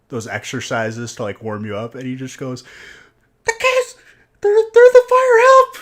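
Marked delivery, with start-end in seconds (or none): 3.4-5.8 very high, loud mock shriek; comic panicked yell for help; imitated screaming. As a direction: scream it in a piercing falsetto, hammed up. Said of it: "He just starts screaming".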